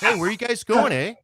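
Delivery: high, hammed-up Canadian question ending in "eh?"; mock Canadian. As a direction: call out like a cartoon Canadian. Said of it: "Sorry, eh?".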